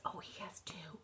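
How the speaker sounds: hushed secret-sharing whisper. Acted. Teacher whispers cookie count aside.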